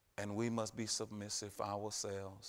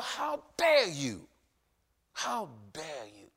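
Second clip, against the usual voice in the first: slow, higher, louder sneer, then dropping back; devil's taunt; 4 semitones higher, 7 dB louder, slower than usual. Acted. Preacher voicing the devil to a sinner, slow sneer. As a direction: drag out the devil's scorn. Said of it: "the devil whispers in the ears".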